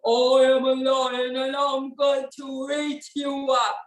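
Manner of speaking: slow, drawn-out lion on a held level tone; menacing storybook lion, words dragged long. Shown as a demonstration. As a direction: stretch it out as a big slow lion. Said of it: "this is a kind of a lion voice".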